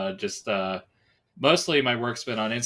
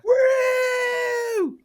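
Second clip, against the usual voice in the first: excited whoop; 5 dB louder than usual. Real, not acted. Guest cheers at his entrance.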